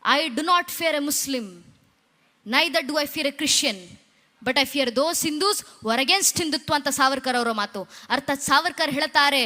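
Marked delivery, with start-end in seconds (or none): 0.1-6.7 very slow, deliberate quoted English, each phrase set apart; quoting Savarkar slowly. Retold or imitated. Preacher quotes Savarkar to the gathered devotees, slow and deliberate. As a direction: weigh every word of the quote so the crowd hangs on it. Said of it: "ಗಮನ ಇಟ್ಟು ಕೇಳಿ".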